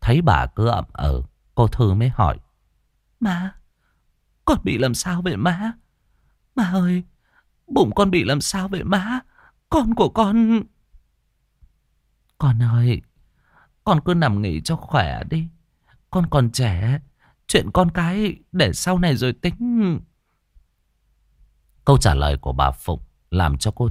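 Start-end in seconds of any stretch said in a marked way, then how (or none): 3.2-10.6 plead, dreading the answer; desperate pleading. Acted. Wife begging mother-in-law about her baby, desperate. 12.4-20.0 put-on lightly higher soothing old mother; calm her while dodging the truth; evasive comfort. Mother-in-law evading the wife's question, gently soothing.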